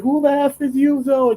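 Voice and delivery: high, put-on anime character voice; imitating a character. Anime fighter confronting the surrounded hero, high mock voice. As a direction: squeak it out high like a cartoon tough guy. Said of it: "He's like".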